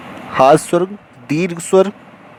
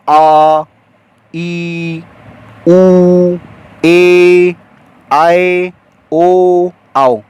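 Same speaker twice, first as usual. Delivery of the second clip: each vowel stretched, slow and loud; drawn-out long vowels; 4 dB louder, much slower than usual. Shown as a demonstration. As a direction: hold every vowel long to show its length. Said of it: "जैसे इनको बोलने में कैसे अधिक समय लगता है". (हर स्वर को खींचकर लंबा बोलें)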